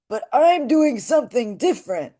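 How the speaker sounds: high dull-nasal character voice; sit back in the throat, send it up through the nose; dull mixed with nasal. Shown as a demonstration. Teacher contrasting her mixed voice, dull sent through nasal. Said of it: "I'm actually mixing the dull with the nasal".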